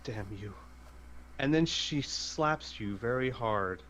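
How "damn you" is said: low, soft and bitter; hushed curse under her breath; quiet. Acted. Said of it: "She says".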